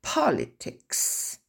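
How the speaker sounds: slow Politics, stress on POL. Shown as a demonstration. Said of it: "les lettres rouges marquent l'accent tonique". (accent tonique sur POL dans Politics)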